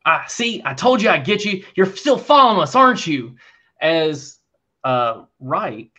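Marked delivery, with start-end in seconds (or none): 0.3-3.3 loud, high taunting shout; mock gloating shout. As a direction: gloat loudly, caught you. GM voices ambusher catching party.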